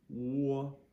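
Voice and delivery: WO as "waw", high and level. Shown as a demonstration. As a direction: hold WO loud like a New Jersey vowel. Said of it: "That's what we're looking for".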